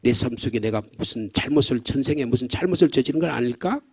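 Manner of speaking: ordinary talk, nothing marked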